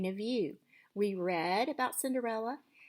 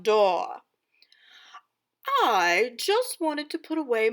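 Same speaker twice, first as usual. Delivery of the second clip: loud, lively storybook narration; dramatic story reading; 9 semitones higher, 15 dB louder than usual. Acted. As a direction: read it aloud like a theatrical stepmother. Teacher reads stepmother's story aloud.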